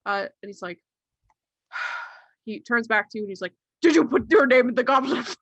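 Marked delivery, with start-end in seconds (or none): 3.8-5.4 loud, high, frantic questioning; urgent mock interrogation. Doctor turns back to boy, jokingly overwrought demand. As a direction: burst out with an over-the-top accusation. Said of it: "in that tone of voice".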